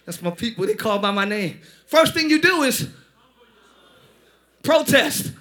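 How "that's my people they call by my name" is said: much lower and softer, fond claiming of his people; tender God voice. Retold or imitated. Preacher voicing God fondly claiming his people, lower and softer. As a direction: say it low and gentle, like a proud father. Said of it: "God is looking at you like".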